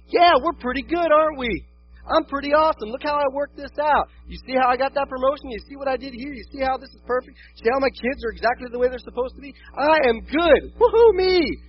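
high, loud mock self-congratulation; hammed-up pleased-with-myself crowing; smug bragging. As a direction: boast excitedly, building to a whooping cheer.

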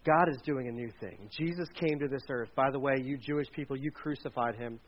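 a low, understated Peter; Peter speaking. Retold or imitated. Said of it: "and he said".

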